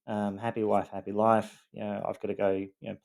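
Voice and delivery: normal talking voice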